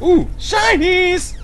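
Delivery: excited squeal. Real, not acted. Finds sparkly item in game.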